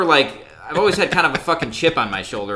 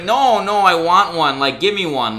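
mock drunken insistence, pitched up and louder; insistent demand; 5 semitones higher, 5 dB louder than usual. Drunk woman pushing the clerk for one more, mock insistent.

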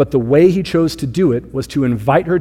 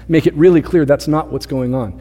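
ordinary delivery

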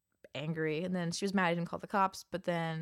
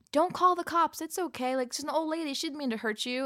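worker pleading; 8 semitones higher, 4 dB louder than usual. Retold or imitated. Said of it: "and was like".

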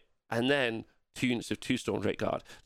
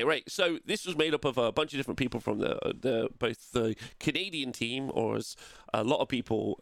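usual speaking voice

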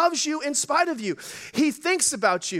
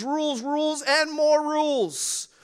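ordinary talk, nothing marked

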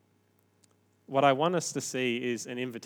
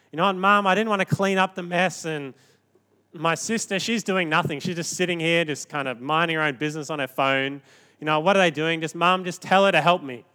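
whiny sibling; 4 semitones higher, 6 dB louder than usual. Retold or imitated. Sibling whining to Mum.

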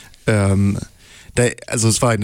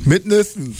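high silly echo; 9 semitones higher, slower than usual. Pretend.

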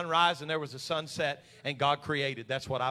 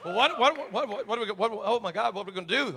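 mock panic; 5 semitones higher, 7 dB louder than usual. Acting out panicked church members.

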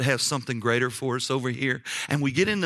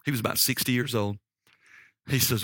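normal talking voice

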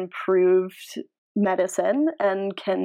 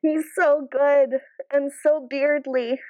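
slow, gushing, a touch choked up; lightly teary adoration; getting emotional; 6 semitones higher than usual. Real, not acted. Fan gushing over her favorite player re-signing, a hint teary. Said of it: "reminiscent of that time I cried".

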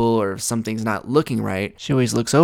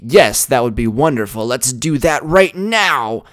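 louder, higher, hammed-up delight; eager enthusiasm; 3 semitones higher, 7 dB louder than usual. Shown as a demonstration. Showing the girlfriend the right, overjoyed answer. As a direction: gush like it's the best idea ever. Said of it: "and should be".

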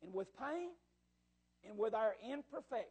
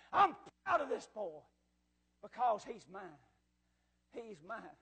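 tender claiming; 4 semitones lower than usual. Acted. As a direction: let the pride settle into a hushed assurance. God tenderly claims the boy.

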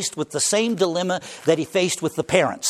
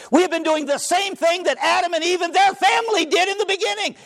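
high, ringing preacher's climb; preaching at full force; 11 semitones higher than usual. Real, not acted. Pastor stirs the congregation, preaching higher and higher. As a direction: lift the congregation with rising fervor. Preaching climax, rising pitch.

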